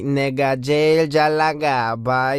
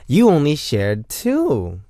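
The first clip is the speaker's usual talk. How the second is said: ordinary talk, nothing marked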